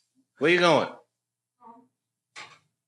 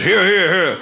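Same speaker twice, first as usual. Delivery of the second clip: loud, higher-pitched mock cheer of assent; British parliament heckle; 5 semitones higher, 7 dB louder than usual. Comic showing the audience how Parliament cheers, British heckle. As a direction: call out like a rowdy British backbencher. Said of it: "supposed to do the British".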